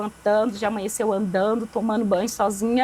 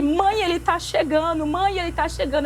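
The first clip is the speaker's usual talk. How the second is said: higher-pitched mock calling out; retold shouting; 6 semitones higher than usual. Sick girl alerting mother that someone is coming, retold shouting. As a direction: imitate an urgent call without a full yell. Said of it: "Eu gritei várias vezes".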